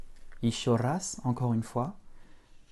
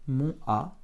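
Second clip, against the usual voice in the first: ordinary talk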